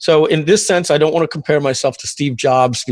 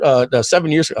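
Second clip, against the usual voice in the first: normal talking voice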